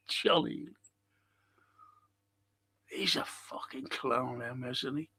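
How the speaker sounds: soft, breathy whisper, words slowed; whispered insult. Real, not acted. Caller whispering to Steve that Ely is a clown, hushed. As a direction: hush it like a sneaky aside.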